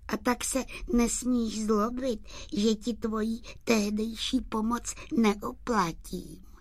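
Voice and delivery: high, gentle doll voice; high-pitched, delicate and kind; silvery little voice. Acted. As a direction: answer in a small silvery voice. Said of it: "stříbrným hláskem".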